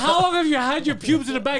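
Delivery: high, loud, laughing question; laughing disbelief. Retold or imitated. Clinic worker asking the friend about his bag, laughing. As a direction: ask it cracking up.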